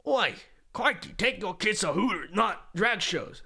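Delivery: hammed-up, badly faked Australian accent. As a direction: have a go at Aussie, badly. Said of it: "I can't do an Australian accent".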